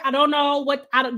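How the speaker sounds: ordinary talk, nothing marked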